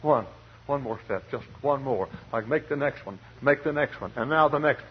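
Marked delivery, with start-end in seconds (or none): 0.0-2.1 soft, worn-out muttering to yourself; tired self-talk. Retold or imitated. Exhausted runner urging himself on, soft and worn. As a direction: urge yourself on under your breath. I'd say.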